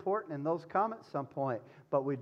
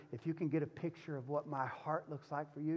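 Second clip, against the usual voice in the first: ordinary talk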